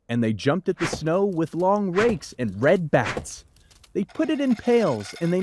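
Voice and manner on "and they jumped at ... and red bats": ordinary delivery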